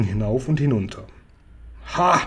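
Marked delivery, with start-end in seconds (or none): 2.0-2.2 mild vorgelesenes Riesengebrüll; brüllender Riese. A narrator's reading. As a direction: ein kurzer, kräftiger Riesenausruf. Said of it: "brüllte der Riese". (brüllend wie ein Riese, vorgelesen)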